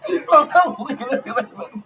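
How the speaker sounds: high burst of laughter; big laugh. Real, not acted.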